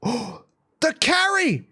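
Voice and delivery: very high, stretched-out cry; gasp, then a high drawn-out call; excited play-by-play. Real, not acted. Caster calls a sudden play, excited. Live match commentary at climax.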